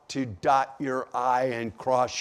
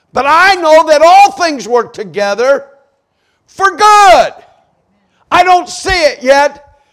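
fervent praise; 11 semitones higher, 11 dB louder than usual. Acted. Psalmist reminding himself God makes no mistakes, fervent loud praise. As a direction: proclaim trust in God at full force. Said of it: "He's reminding himself, praising God".

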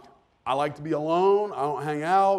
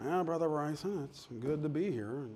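much softer, slower, lifeless mumble; grumpy guest preacher; 9 dB quieter than usual. Retold or imitated. Kent York grumbling at lunch, gloomy slow mumble. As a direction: drag the words like lunch is misery. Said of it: "He's like".

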